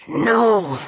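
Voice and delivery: restrained, growled refusal; snarl. A narrator's reading. Peter refuses to share his prey, snarling. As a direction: snarl it through the teeth. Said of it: "formed itself in a snarl".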